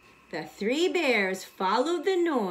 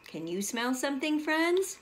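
put-on asking the children; 4 semitones higher than usual.

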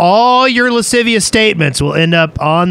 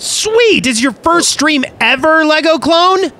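high, eager welcome to a new viewer; thrilled, rising question; excited greeting; 9 semitones higher than usual. Real, not acted. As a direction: burst out with high-pitched enthusiasm. Greets first-time viewer with excitement.

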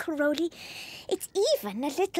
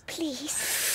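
whispering; 5 dB quieter than usual. Real, not acted. Eager whispered reply.

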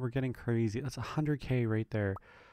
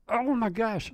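high-pitched, louder exclamation; mock yell; 12 semitones higher, 4 dB louder, slower than usual. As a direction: cry out in a held-back yell. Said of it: "not gonna yell into the microphone".

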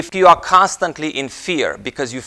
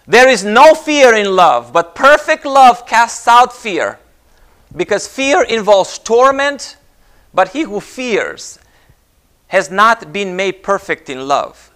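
slow, raised preaching voice; scripture recitation; 6 semitones higher, 6 dB louder, slower than usual. Real, not acted. Preacher quoting First John to the congregation, slow proclamation. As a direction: proclaim the verse slowly, phrase by phrase.

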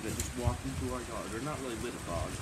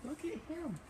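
soft coo at dog; 13 semitones higher, 7 dB quieter than usual. Real, not acted. Softly cooing at dog.

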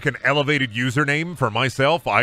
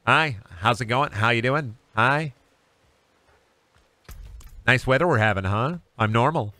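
lightly voiced bland small-talker; normal SpongeBob. Playing SpongeBob turned normal, lightly put-on normal voice. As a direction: make polite small talk, a hint of mock-normal. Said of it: "where he becomes normal".

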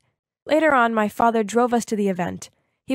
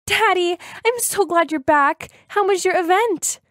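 excited; 8 semitones higher, 4 dB louder than usual. Acted. Girl rushes to greet father.

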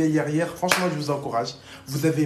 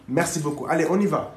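ordinary delivery

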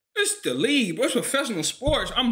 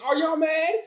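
mock taunt; 5 semitones higher, slower than usual.

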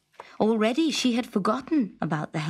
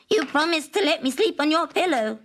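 frog voice; 6 semitones higher than usual. A narrator's reading. Frog reminding princess.

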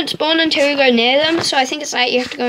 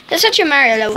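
ordinary talk, nothing marked

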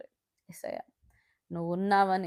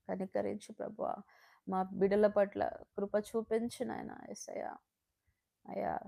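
softer, intimate prayer to God; hushed prayer; 4 dB quieter than usual. Real, not acted. Pleading with God over straying children, softly. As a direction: pray softly and earnestly.

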